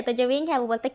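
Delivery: ordinary delivery, nothing marked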